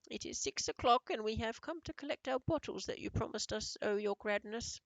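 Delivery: timid witches grovelling to their queen; meek old witch. A narrator's reading. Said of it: "said a meek voice".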